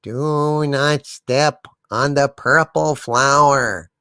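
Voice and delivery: put-on a slow, low old witch's warning. A narrator's reading. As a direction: draw it out like a creepy old crone. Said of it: "she slowly says".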